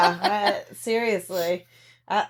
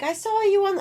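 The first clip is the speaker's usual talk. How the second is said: put-on New Zealand accent; 13 semitones higher than usual. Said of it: "I'm not even gonna try to do a New Zealand accent".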